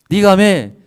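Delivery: loud, high acted command; king's demand. King demanding the woman's story, loud acted command. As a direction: order her loudly to speak up.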